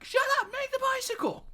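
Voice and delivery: put-on very high, loud bratty kid yell; a bratty kid yelling at the clown. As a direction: scream the order at him like a spoiled child. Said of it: "Why are you yelling at me".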